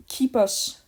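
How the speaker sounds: usual speaking voice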